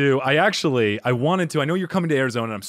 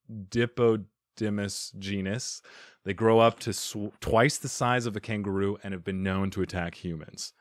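usual speaking voice

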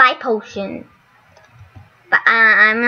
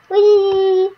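excited squeal; 8 semitones higher than usual. Real, not acted. Launching into the hatch.

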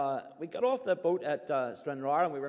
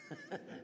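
high laugh; 12 dB quieter than usual. Real, not acted. Punchline laugh.

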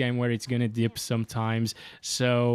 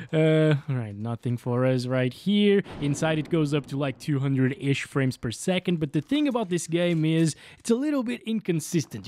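ordinary talk

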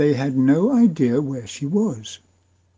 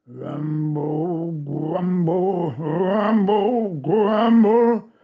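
growing rumble; 4 semitones higher, much slower than usual. A narrator's reading. Narrator voicing the giant's approaching noise, building louder. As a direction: chant the rumbles, louder and higher each time. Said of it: "The noise was getting louder and louder".

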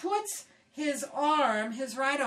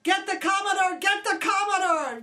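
urgent shout; 8 semitones higher, 7 dB louder than usual. Retold or imitated. Officer on the bridge shouting for the Commodore in alarm. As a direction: call it out high and loud, alarmed. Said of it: "and he said".

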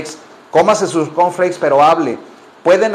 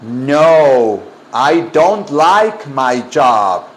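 very slow, drawn-out negative answer; deliberate word-by-word drill; slow modelling; 2 semitones lower, much slower than usual. Shown as a demonstration. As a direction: stretch every word for the class.

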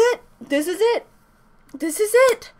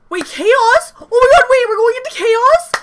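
loud, high incredulous questions; shocked disbelief; 7 semitones higher, 7 dB louder than usual. Real, not acted. Fan stunned by the chaos chapter reveal, shocked. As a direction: blurt it out, stunned, voice jumping up.

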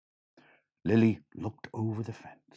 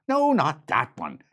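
correcting leprechaun; 7 semitones higher, 8 dB louder than usual. Acted. Leprechaun corrects Lily again.

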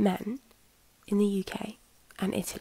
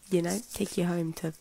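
usual speaking voice

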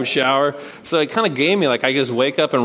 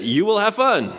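higher, commanding "You will have fun"; mock coach command; 8 semitones higher than usual. Coach ordering students, hammed-up higher command. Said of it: "So he commanded it".

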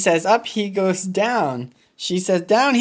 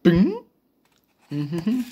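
ordinary delivery

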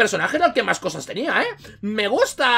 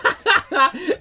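laughing after a mock kill; high gleeful laugh; 8 semitones higher than usual. Real, not acted. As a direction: burst into high-pitched laughter. Risa tras matar al Terminator. (carcajada aguda)

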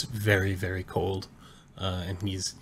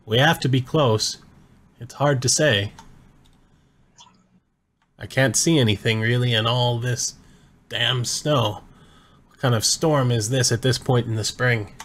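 shouting over wind; 4 semitones higher, 6 dB louder than usual. Acted. Durbin shouting through blizzard.

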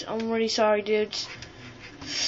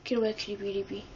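low, much softer cooing; cooing at cat; 5 semitones lower, 12 dB quieter, faster than usual. Real, not acted. Gamer quietly shooing his cat off, cooing. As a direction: shoo the kitty gently.

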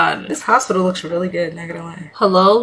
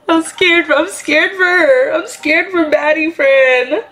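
higher, slow mock fright; hammed-up fear; 6 semitones higher, slower than usual. Player fearing for Baddie Friend, higher slow mock fear. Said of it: "I'm such a good actor".

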